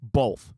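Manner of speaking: "Both" with an L sound before the th. Shown as a demonstration. Host asked to say it, "Both" pronounced "bolth". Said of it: "I say it with an L".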